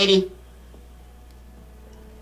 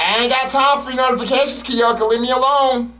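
annoyed snapping; 8 semitones higher, 4 dB louder than usual. Real, not acted. Snapping at teammate.

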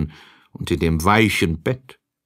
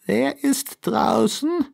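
put-on much higher frail old woman calling from bed; old grandmother calling; 13 semitones higher than usual. A narrator's reading. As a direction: call out as the bedridden grandmother. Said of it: "und rief".

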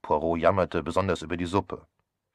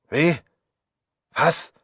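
shocked; 9 semitones higher, 7 dB louder than usual. Acted. Shocked at attempted murder.

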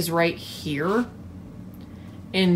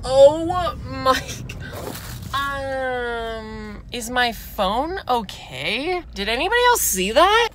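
startled; 5 semitones higher, slower than usual. Real, not acted. Driver startled by something on road, asks viewers in high rushed alarm. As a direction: pitch shoots up much higher, words rushed fast.

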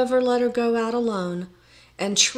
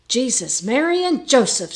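loud, high pious oath; shocked exclamation; 4 semitones higher, 6 dB louder than usual. A narrator's reading. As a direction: exclaim in alarm. Carpenter alarmed at world's end.

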